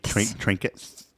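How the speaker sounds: slow Trinkets, S between teeth; lisp. Shown as a demonstration. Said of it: "The S, like, in between your teeth".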